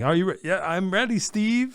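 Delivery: confused musician. Retold or imitated. Mock answering the wrong name to Mike Chapman, high and flustered. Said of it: "you get all confused through your Mike Chapman thing".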